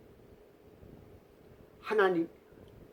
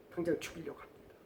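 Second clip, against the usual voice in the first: ordinary talk, nothing marked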